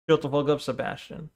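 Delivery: dry, hushed mock scolding; quiet deadpan repeat. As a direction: mutter it under your breath. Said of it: "See, this is my problem".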